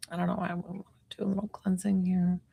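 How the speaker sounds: quick hushed whisper to herself; whispered aside, rushed. Real, not acted. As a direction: breathe it out under her voice, fast. About to do a cleansing.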